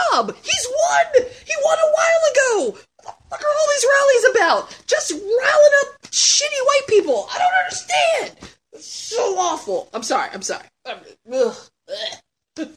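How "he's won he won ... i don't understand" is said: much higher, laughing shouts of disbelief; exasperated rant. Real, not acted. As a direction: let the outrage break into laughter. Ranting about Trump's rallies.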